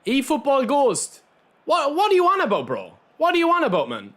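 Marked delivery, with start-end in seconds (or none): 1.6-4.1 pitched up, disbelieving what are you on about; incredulous. Real, not acted. Streamer to a viewer who insulted him, incredulous.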